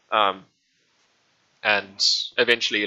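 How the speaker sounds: ordinary talk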